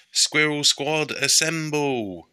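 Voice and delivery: high, slow heroic call; rallying call. Acted. Betty summoning her squad, heroic rallying call. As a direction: summon the team like a superhero. Calling the team.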